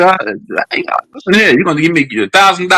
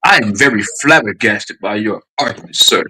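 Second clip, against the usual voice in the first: lower, proper speech, like a stuffy professor; posh professor voice; 6 semitones lower than usual. Pretend. Showing the guest how to talk, in a posh professor voice. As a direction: clean up his language with a mock refined tone. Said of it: "That sound like Peter now?".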